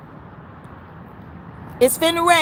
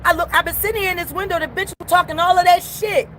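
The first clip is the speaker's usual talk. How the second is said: higher, angrier complaint at the window; heated rant; 5 semitones higher than usual. Real, not acted. Customer at the window complains about staff, heated. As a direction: let them have it, fed up.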